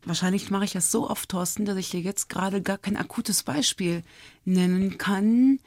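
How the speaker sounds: usual speaking voice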